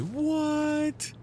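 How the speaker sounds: very high, squeaky what; startled what. Retold or imitated. Streamer replays his own shocked reply, very high. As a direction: yelp it in shock.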